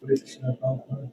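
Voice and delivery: faint, far-off mic voice; barely audible. Real, not acted. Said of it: "Your voice is very low".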